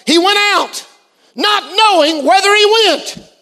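high, ringing verse quotation; preacher declaiming scripture. Real, not acted. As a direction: proclaim the verse to the congregation.

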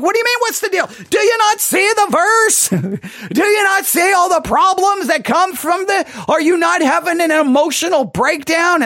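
high-pitched, hammed-up frantic shout; overdramatic exasperation. Preacher berating unmoved congregation, melodramatically exasperated. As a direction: wail in theatrical disbelief at their indifference. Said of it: "You're too dramatic".

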